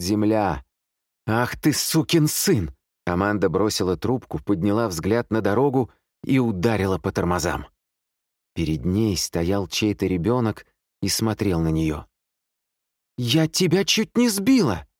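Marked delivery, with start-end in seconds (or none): 1.3-2.7 high, drawn-out outraged curse; angry curse. A narrator's reading. Amanda swears at the sergeant, angry outburst. 13.2-14.9 very high-pitched shocked exclamation; alarmed cry. A narrator's reading. Driver to a child she nearly hit, alarmed cry. Driver yells after near accident.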